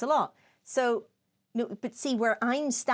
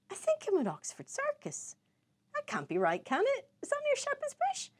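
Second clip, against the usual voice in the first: mock confusion; 4 dB quieter than usual. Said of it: "the next text was like".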